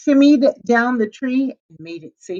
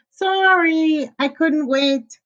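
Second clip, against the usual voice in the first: little pig voice; 8 semitones higher, much slower than usual. A narrator's reading. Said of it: "said the little pig".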